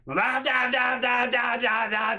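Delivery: imitated high-pitched yelling blahs; mom screaming. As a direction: yell nonsense right in someone's face. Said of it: "she's screaming at me".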